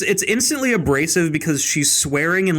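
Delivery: normal talking voice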